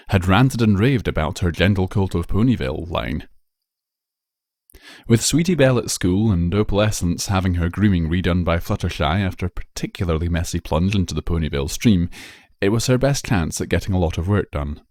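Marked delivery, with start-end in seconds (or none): none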